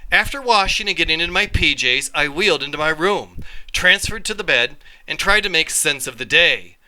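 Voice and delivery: ordinary delivery, nothing marked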